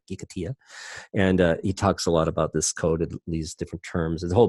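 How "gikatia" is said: softer Gikatia, Spanish y in place of l; Spanish pronunciation. Shown as a demonstration. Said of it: "his Spanish accent there".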